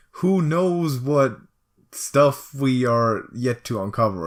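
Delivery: slow, loud and high explorer boast; adventurer voice. Pretend. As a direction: declare it like a swashbuckling explorer. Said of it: "I'm trying to make the voice happen too".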